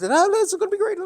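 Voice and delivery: mock excitement. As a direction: gush like a TV pitchman.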